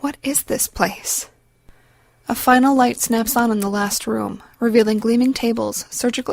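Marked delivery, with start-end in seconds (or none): 0.0-1.3 quiet, slow, higher frightened question; trembling quiet. A narrator's reading. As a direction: ask it softly, slowly, afraid. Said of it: "Voice trembling slightly, Anna asks quietly".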